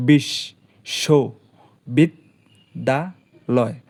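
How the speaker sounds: বিশ্ববিদ্যালয় slowly split into five beats; বিশ্ববিদ্যালয় broken into five slow syllables. Shown as a demonstration. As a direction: draw out বিশ্ববিদ্যালয় one syllable at a time, very slow. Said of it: "বাংলায় ভেঙ্গে উচ্চারণ করি".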